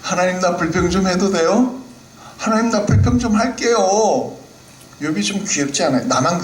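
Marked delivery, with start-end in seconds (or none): 0.0-4.4 higher, pleading little voice; a child asking God's permission; cute complaining Job. Acted. Job playfully asking God if he may complain. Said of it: "욥이 좀 귀여워 보이지 않습니까".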